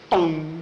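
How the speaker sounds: imitated gulp of a dog; dog snapping up food. Ritzy grabbing tossed bacon, a chomping gulp. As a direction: voice one quick doggy chomp. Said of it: "he grabbed it in his chops".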